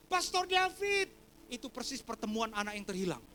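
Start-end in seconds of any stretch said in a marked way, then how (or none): 0.1-1.1 high, loud drawn-out greeting; imitated holler from a friend; excited shout. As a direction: shout it back in delighted recognition. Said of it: "Langsung dia teriak".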